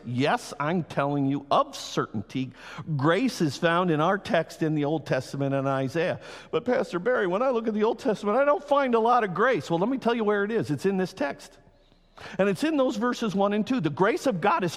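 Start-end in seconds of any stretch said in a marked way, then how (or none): 6.5-9.7 lightly voiced skeptic, higher and quick; doubting listener. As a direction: toss out the objection like a doubter.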